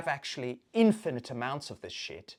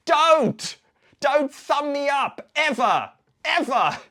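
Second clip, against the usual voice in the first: loud, high, performed outburst; emphatic shouting; 11 semitones higher, 11 dB louder, much slower than usual. Acted. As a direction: shout each phrase as a slow, high warning. Angry telling-off, performed.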